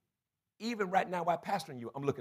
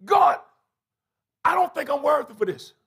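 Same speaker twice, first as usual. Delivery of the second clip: pleading cry; 7 semitones higher, 7 dB louder than usual. Acted. Preacher voicing his plea to God.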